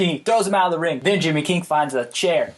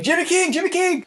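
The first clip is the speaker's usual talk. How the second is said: calling out; 12 semitones higher than usual. Retold or imitated. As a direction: yell his name to get his attention. Calling out through the window.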